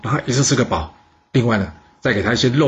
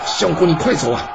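high, urgent cry; urgent shout; 6 semitones higher than usual. A narrator's reading. Bodyguard shouts to the minister to flee, urgent cry. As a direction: shout at him to run. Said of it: "大叫".

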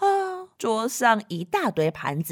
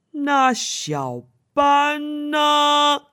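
slow question; 5 semitones higher, much slower than usual. Acted. Nanny asking, drawn out.

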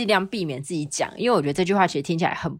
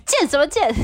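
mock scolding dad; 9 semitones higher, 4 dB louder than usual. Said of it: "被我爸大声斥责".

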